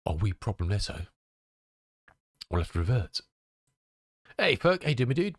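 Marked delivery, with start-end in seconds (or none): none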